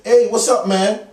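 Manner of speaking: loud, high-pitched hearty greeting; calling out. Acted. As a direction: holler a greeting to someone across the street. Calling out to young man.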